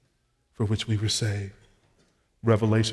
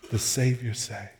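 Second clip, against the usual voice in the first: usual speaking voice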